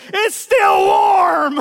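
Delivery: drawn-out hillbilly holler. Retold or imitated. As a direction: ham up a country guy stating the obvious. Redneck tow guy quoted.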